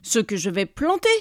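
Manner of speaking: indignant yell. A narrator's reading. Neighbour answers about vegetables not yet planted, indignant yell. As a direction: throw the retort back forcefully. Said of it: "hurla le voisin".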